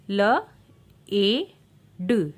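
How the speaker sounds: ल, ए, ड as three separate sounds with pauses; sounding out. Shown as a demonstration. Phonics teacher breaking a word down, sounding out ल, ए, ड. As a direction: punch each sound apart: ल, long ए, ड. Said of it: "ए का long sound होगा".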